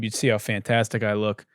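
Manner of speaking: normal talking voice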